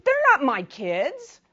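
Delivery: loud, high, drawn-out mock outrage; vicious retort. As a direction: imitate a woman snapping back viciously. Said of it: "she was, like, vicious".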